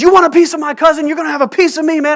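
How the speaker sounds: high, loud protective shout; mock tough shout. Cousin Josh yelling at the bully, high loud shout. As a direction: shout it like a big cousin stepping in. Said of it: "He says".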